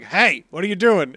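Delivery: loud mock telling-off; retold yell at slackers; imitated yelling. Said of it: "Polly's yelling at them".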